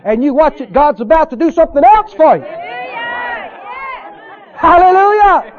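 building to a high, loud hallelujah; rally the congregation; fervent preaching. Real, not acted.

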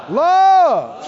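loves hit loud and high; preacher shouting loves to the crowd; shouted loves. Real, not acted. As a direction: punch the word loves. Congregation prompted to say it.

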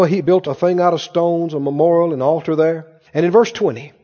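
ordinary delivery

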